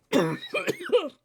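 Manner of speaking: sudden loud cough. Real, not acted. Still choking, says pardon.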